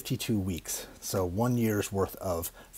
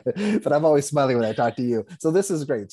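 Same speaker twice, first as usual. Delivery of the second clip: laughing; 6 semitones higher, 7 dB louder than usual. Real, not acted. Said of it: "I always like to start these, like, mid laugh".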